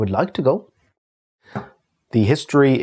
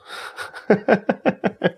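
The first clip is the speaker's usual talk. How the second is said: amused laugh; 10 semitones higher than usual. Real, not acted. Laughing about last night's call.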